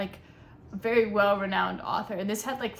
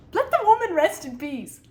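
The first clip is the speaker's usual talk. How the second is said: louder, higher, pleading; exasperated plea; 8 semitones higher, 9 dB louder than usual. Real, not acted. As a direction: beg the book to stop. Scolding the narrator.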